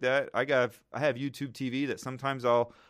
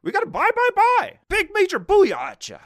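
much higher, louder, frantic TV stock-pundit yell; hyped-up Kramer; 10 dB louder than usual. Retold or imitated. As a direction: ham up a manic TV stock picker screaming buy. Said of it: "the guy's like".

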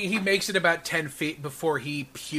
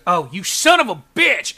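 furious shout; 3 semitones higher, 9 dB louder than usual. Acted. Priest dragged into portal.

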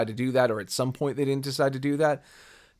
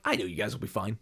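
low, quiet, unbothered aside; detached; 5 semitones lower, 8 dB quieter, faster than usual. Retold or imitated. Viewer to characters in no danger, detached. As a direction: drop down soft, feeling no threat at all. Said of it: "I'm so detached".

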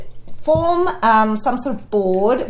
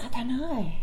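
sheepish mumble; 11 dB quieter than usual. Retold or imitated. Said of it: "I say".

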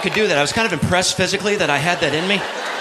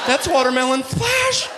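shouted punchline; 10 semitones higher, slower than usual. Acted.

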